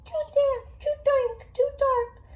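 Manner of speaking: squeaky mouse voice. Acted. Parent voicing the mouse for a child, very high squeaky voice. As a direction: squeak it out like a fussy little mouse. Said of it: "Mouse squeaks".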